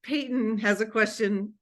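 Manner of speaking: usual speaking voice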